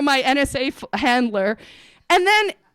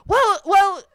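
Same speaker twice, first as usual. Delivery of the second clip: higher and louder hammed-up well, well; imitating fact checker; 6 semitones higher, 3 dB louder than usual. Fact checker trying to help, hammed-up high well well. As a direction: play her brightly searching for words. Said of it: "She goes".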